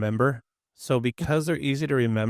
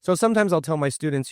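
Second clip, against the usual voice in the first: normal talking voice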